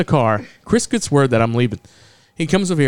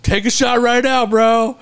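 pitched-up mock drunk insistence; pushy drunk bro; 8 semitones higher than usual. Drunk friend pushing a shot on the cashier, mock pushy.

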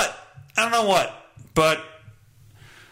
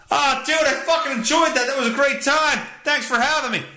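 mock bro voice; 6 semitones higher than usual. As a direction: play an excited bro thanking his host. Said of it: "No longer do we say".

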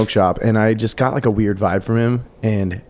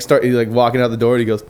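ordinary delivery, nothing marked

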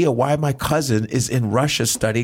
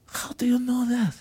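high, whispered awe; amazed Russian host; 14 semitones higher, 4 dB quieter than usual. Retold or imitated. Russian host whispering in awe to the comedian. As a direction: gasp it out in a high hushed voice.

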